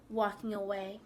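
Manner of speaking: plain reading voice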